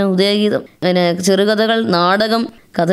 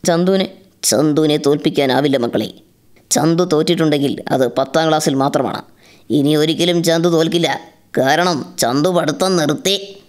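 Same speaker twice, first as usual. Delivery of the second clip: lower, heavy comic Mammootty voice; Mammootty impression; 6 semitones lower than usual. Mimicking Mammootty's famous line as a comic spoof. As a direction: growl it out low like the star, for laughs. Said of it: "മമ്മൂക്കയുടെ ... comedy ആയിട്ട് ചെയ്യാറുണ്ട്".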